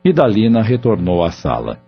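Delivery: ordinary talk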